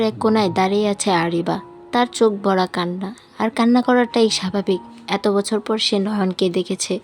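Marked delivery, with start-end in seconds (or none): none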